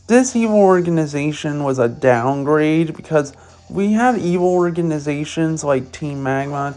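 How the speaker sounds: usual speaking voice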